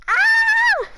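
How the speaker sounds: high, loud delighted shriek; excited squeal. Real, not acted. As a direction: squeal with joy. Excited about new mic.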